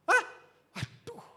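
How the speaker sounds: high-pitched shocked exclamation; startled dismay. Retold or imitated. Father hearing child fears disappointing him, startled dismay. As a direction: react aghast to the news. Acts dismay at teacher's news.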